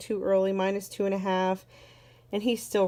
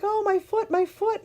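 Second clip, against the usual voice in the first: put-on high, whiny child's voice, much higher; imitating crying toddler; 13 semitones higher than usual. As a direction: whimper it like a small kid with a sore foot. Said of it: "he kept crying, like".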